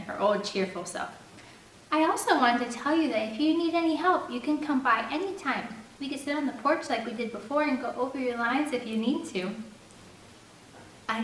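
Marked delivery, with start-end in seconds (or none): 1.9-9.6 lightly voiced bright girl, higher; a hint of an eager helper; Jamie's sweet offer. A narrator's reading. Jamie offering to rehearse with Landon, lightly sweet.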